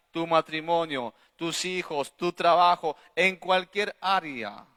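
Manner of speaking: loud, emphatic sermon delivery; full-force preaching. Real, not acted. Pastor tells church grace helps in every area, full-force preaching. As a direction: drive each point home to the congregation.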